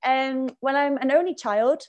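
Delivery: normal talking voice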